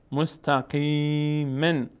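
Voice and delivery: مستقیمن slowly, long "qee", ending in "men"; kasra tanwin sounded. Shown as a demonstration. Teacher gives an example of double kasra, ending sounded. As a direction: draw out مستقیمن and voice the final "-en". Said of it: "تنوین ان دو تا کسره".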